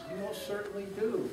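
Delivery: lightly voiced tiny mayor; piped tiny voice. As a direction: chirp it up from far below. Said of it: "piped the voice".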